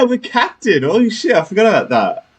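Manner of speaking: ordinary talk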